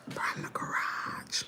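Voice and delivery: slow, whispered punchline; barely-there whisper for the big reveal; hushed reveal. Retold or imitated. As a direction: whisper it in drawn-out disbelief. Dramatic reveal of where she emerged.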